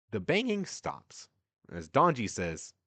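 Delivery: ordinary talk, nothing marked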